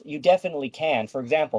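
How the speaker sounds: ordinary talk, nothing marked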